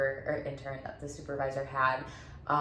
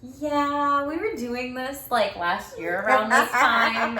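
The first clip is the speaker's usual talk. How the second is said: excited; 8 semitones higher, 9 dB louder, slower than usual. Retold or imitated. Retelling own reply, excited.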